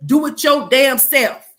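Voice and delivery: loud, slow, sassy refusal; hammed-up sassy employee talking back; mock defiant worker. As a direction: throw it back at the boss with attitude. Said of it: "be like".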